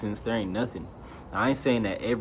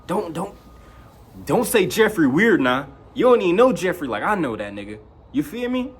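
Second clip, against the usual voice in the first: higher, louder defiant comeback; indignant retort; 7 semitones higher, 5 dB louder than usual. Retold or imitated. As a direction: snap back defending Jeffrey. Retorts to school kids insulting Jeffrey.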